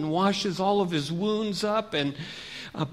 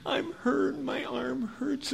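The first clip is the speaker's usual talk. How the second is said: high, soft, drawn-out begging; pleading cry; 8 semitones higher, 6 dB quieter, much slower than usual. Acted. Wounded man calls to the Levite for help, pleading. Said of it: "He yells out".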